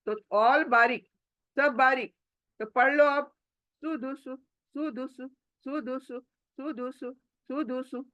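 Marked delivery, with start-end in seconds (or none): 3.8-8.1 सुदुसु run together, short and soft; quick short vowels. Shown as a demonstration. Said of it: "जल्दी, जल्दी". (जल्दी-जल्दी सुदुसु, बिना खींचे)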